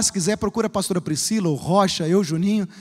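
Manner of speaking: normal talking voice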